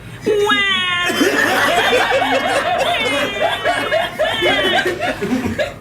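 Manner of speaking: put-on high, long drawn-out baby wail; storyteller's high-pitched baby cry. A narrator's reading. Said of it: "started to cry".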